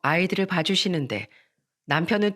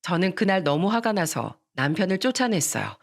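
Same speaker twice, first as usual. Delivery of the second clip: ordinary delivery, nothing marked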